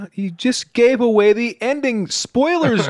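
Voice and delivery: high-pitched fake indignation; mock outrage. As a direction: play at being outraged. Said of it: "I'm just kidding".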